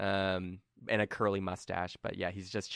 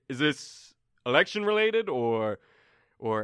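Turi wary; 4 semitones higher, 4 dB louder, slower than usual. Acted.

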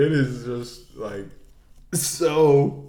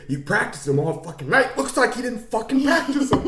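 high mock scolding, rising into laughter; imitated angry actor; 11 semitones higher than usual. Actor scolds himself over practicing lines, high mock rage. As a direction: berate yourself, hammed-up. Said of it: "You're really good at this impression".

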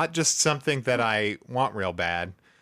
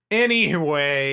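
drawn-out anyway; 3 semitones higher, 5 dB louder, slower than usual. Acted. Slow drawn-out change of topic.

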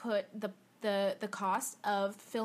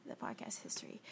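whispering; 6 semitones lower, 10 dB quieter than usual. Real, not acted. Hushed aside after on-air idea.

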